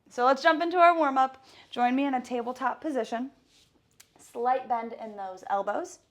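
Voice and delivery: loud, fast workout coach; fitness instructor. Acted. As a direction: pump up the class. Leads a workout warm-up.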